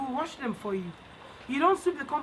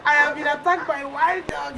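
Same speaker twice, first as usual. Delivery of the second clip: put-on a boy's scream, read out loud; loud cry of a boy bitten by dogs; mild narrated scream; 3 semitones higher, 6 dB louder than usual. A narrator's reading. Storyteller voicing the bitten boy's scream to the children, loud. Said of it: "he screamed".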